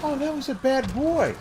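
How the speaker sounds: high, sing-song voice to a dog; sweet high praise tone. Shown as a demonstration. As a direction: coo it like praising a puppy. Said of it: "your inflection is more important than what you say".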